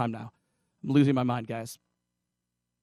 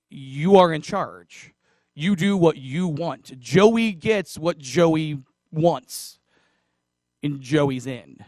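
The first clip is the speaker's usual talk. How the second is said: slow emphatic; much slower than usual. Acted. Preacher drives home point.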